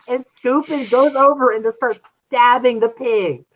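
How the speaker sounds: usual speaking voice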